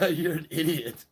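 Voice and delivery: ordinary delivery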